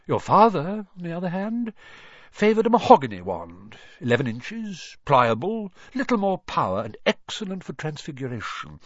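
put-on animated old shopkeeper reminiscing; old wandmaker. A narrator's reading. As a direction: recall each wand like a fond connoisseur.

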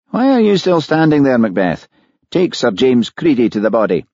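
sharp mild rebuke; annoyed superintendent. A narrator's reading. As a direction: snap an order at a subordinate. Davitt scolds Hamish.

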